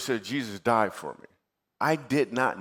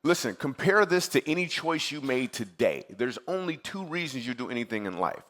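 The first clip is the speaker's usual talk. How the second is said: usual speaking voice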